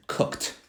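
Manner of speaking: COOKED as one syllable ending in a crisp t. Shown as a demonstration. Teacher modelling the past tense of cook, t ending. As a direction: say COOKED with a k-t finish. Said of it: "I pronounce the E D differently".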